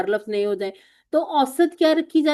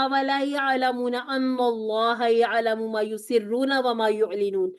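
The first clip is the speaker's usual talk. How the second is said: Quranic recitation; slower than usual. Shown as a demonstration. Teacher recites Quran verse.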